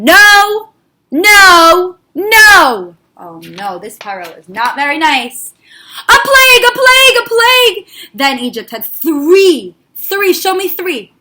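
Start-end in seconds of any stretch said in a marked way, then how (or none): 0.0-3.0 loud angry refusal, each no punched; a stubborn king yelling no; Paro shouting no. Acted. Paro refuses Moshe, shouting angrily. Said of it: "Paro shouted again". 6.1-7.9 high, loud wailing cry of doom; dramatic cry. Acted. Storyteller crying out the plague refrain dramatically.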